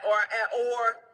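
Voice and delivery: ordinary talk, nothing marked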